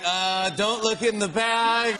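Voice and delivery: slow, drawn-out guilty stalling; mock guilty voice. Host voicing guest caught in the photo, mock guilty. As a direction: stretch it out, imitating someone caught.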